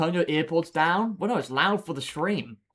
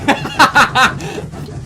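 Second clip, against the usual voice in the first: high, loud airy laugh; loud wheezing laugh; 10 semitones higher, 11 dB louder than usual. Real, not acted. Reacting to chat's joke, loud airy laugh. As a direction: burst out laughing.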